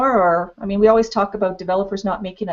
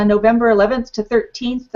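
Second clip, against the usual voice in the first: ordinary talk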